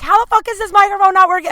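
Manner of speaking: high, loud furious yell at the microphone; mad outburst. Retold or imitated. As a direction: explode in frustration at a broken mic. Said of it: "and I got real mad".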